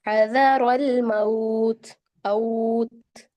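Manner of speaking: very slow हज़रल मौत with a drawn au; tajweed demo. Shown as a demonstration. Teacher recites verse nineteen, very slow. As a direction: stretch मौत into maut, not mot. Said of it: "उन्नीस में देखें".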